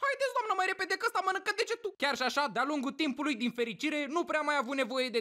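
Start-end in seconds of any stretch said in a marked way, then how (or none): 0.1-1.9 high, rushed panicky urging; mock panic. Father with bitten finger begging the dentist, mock panicked. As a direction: hurry her up in a high frantic voice. Same plea, next teeth.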